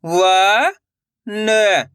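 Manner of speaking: व held long and rising, then न; letter-by-letter demo. Shown as a demonstration. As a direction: sound out व and न separately. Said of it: "एक अक्षर का उच्चारण सही से". (व, न अलग-अलग खींचकर)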